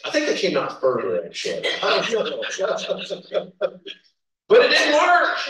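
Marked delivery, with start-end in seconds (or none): 4.5-5.4 high, loud burst of a punchline; big comic declaration; loud exclaim. Retold or imitated. Laughing punchline of ears story.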